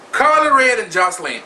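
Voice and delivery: ordinary delivery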